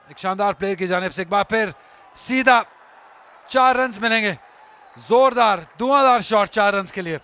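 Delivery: loud live play-by-play; excited cricket commentary. Real, not acted. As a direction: call each boundary with a shout. Shots called live. (उत्साहित cricket commentary)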